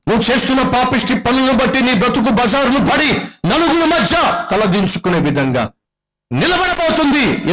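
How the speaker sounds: high, ringing preacher's cry building to a peak; fiery preaching. Acted. Preacher warning the sinful congregation, fiery high preaching. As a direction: preach it out to the whole hall.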